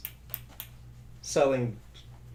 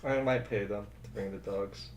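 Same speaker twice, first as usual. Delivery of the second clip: deadpan quiet; 8 semitones lower, 13 dB quieter than usual. Real, not acted. Defiant joke back at S1.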